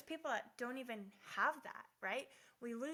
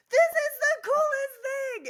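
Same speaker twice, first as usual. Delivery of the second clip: much higher and louder gushing; excited; 17 dB louder than usual. Retold or imitated. Pretending to be a new car owner, thrilled gushing. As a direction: rave like you just got the keys. Her delight with massage seats.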